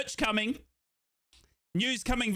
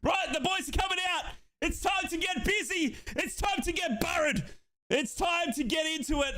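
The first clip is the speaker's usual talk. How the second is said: hyped shout; 10 semitones higher than usual. Real, not acted. Streamer shouting to viewers as the players walk out. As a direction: announce it like a stadium hype man.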